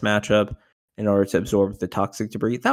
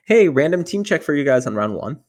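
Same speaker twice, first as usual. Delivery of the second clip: higher, louder retold announcement; lightly voiced judge; 6 semitones higher, 7 dB louder than usual. Judge calling a random check to players, lightly voiced announcement. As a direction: call it out like an official, lightly imitated.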